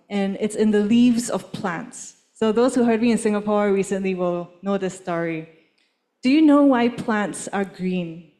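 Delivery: ordinary talk, nothing marked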